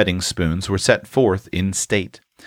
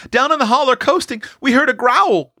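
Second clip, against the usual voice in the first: high, fast, breathless with fright; wide-eyed child. Acted. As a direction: blurt it out like a scared boy. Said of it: "began Sol with his eyes as big as saucers".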